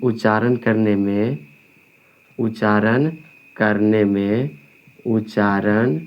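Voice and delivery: slow, word-by-word dictation with pauses. Real, not acted. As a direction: dictate slowly, leaving time to write. Dictating for students to write. (धीरे-धीरे, रुक-रुककर लिखवाते हुए)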